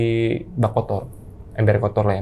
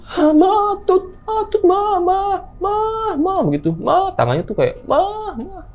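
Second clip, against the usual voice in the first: very high, wailing calls for mama; terrified toddler calling mama; slower than usual. Retold or imitated. Toddler points at the bathroom, calling for mama in terror. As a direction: cry out for your mother in panic, pointing at something. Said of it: "Sambil kelenger".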